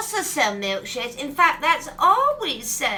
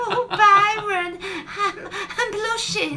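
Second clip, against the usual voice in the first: coy laughing reply; flustered giggle; 5 semitones higher than usual. Acted. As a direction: giggle through it, caught off guard by the compliment. Flattered by a flirt.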